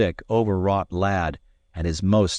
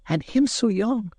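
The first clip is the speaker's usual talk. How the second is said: lamenting cry. A narrator's reading. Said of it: "cries the lass".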